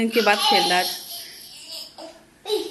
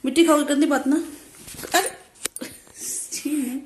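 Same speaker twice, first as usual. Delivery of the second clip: normal talking voice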